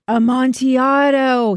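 slow, hammed-up moan. A narrator's reading. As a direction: wail the word slowly, stretching it out. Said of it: "he moaned".

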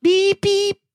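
tiny bird beeps, very high; high squeaky beeps. Pretend. Baby sparrow squeaking while being painted, very high beeps.

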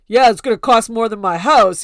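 loud, brash imitation of Joe showing off; mock Joe bragging. Imitating Joe loudly bragging about his expensive luggage. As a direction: boom it out like a smug husband. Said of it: "and he's like".

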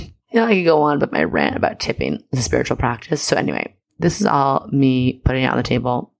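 ordinary talk

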